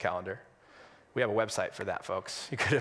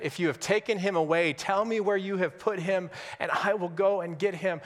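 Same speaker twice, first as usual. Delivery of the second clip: desperate plea; 8 semitones higher than usual. Retold or imitated. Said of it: "can you hear the desperation in Mary's voice".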